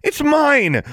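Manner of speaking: very high, loud desperate scream. A narrator's reading. Girl fighting for her time turner, screaming. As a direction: scream the demand. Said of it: "Alexandra screamed".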